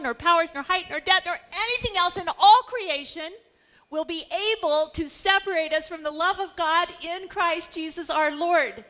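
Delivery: climbing exultant proclamation; rising preached climax. Real, not acted. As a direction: preach it out at full force. Preacher climaxes Paul's list.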